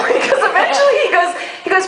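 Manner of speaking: ordinary talk, nothing marked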